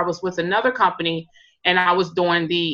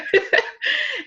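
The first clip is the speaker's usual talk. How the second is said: short high-pitched real laugh. Real, not acted. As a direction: burst into a brief, high laugh. Amused at own pronunciation doubt.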